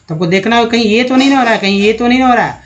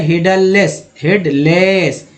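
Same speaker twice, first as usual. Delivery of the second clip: slow model word; much slower than usual. Shown as a demonstration. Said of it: "E double S को S बोलेंगे".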